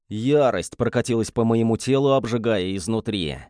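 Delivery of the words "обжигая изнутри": plain reading voice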